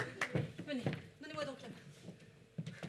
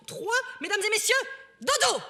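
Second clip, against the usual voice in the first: loud; 5 semitones higher, 12 dB louder than usual. Acted. Climax of countdown.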